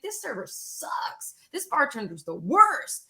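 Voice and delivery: lightly voiced disappointed guests; grumbling diners. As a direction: mutter like unhappy customers. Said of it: "They're gonna be like".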